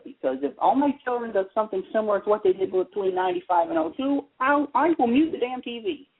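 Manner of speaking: ordinary talk, nothing marked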